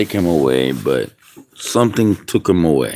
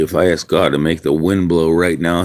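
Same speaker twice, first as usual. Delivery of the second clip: ordinary talk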